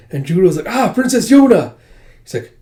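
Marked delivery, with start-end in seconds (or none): 0.7-1.7 high, loud alarmed exclamation; startled cry. Retold or imitated. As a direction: cry out as if shocked to see the princess. Judo cries out at seeing her.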